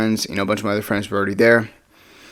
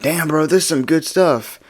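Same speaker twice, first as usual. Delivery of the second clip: higher put-on stoner voice; drunk, high partygoer; 5 semitones higher than usual. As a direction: imitate a high guy admiring it. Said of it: "He's, like, super drunk and high".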